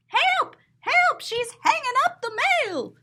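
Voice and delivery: very high, frantic cries for help; panicked mailman. Acted. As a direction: squeal like a mailman running for his life. Mailman fleeing in the picture.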